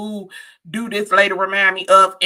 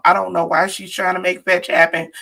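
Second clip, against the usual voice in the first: normal talking voice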